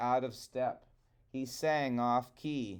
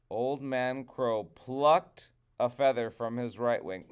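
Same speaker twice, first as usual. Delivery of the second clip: usual speaking voice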